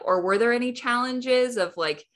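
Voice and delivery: ordinary delivery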